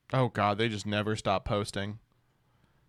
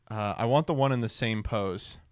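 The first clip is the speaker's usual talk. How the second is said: ordinary talk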